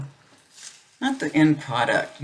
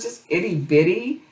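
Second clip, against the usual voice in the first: cooing; 6 semitones higher than usual. Real, not acted. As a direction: coo it like talking to a puppy. Cooing over tiny puppy.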